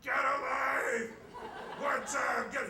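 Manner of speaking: drawn-out, high bellow from outside; full-throated high yell, words stretched; roaring scream. Retold or imitated. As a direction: holler it like a drunk demanding a song. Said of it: "roaring up to the house screaming".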